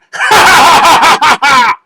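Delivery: loud high villain cackle; evil cackle. A narrator's reading. Villain cackling at his own boast, loud and high. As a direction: cackle in triumph.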